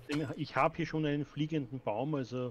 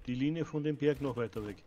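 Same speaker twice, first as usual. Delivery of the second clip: normal talking voice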